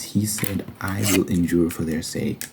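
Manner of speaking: ordinary delivery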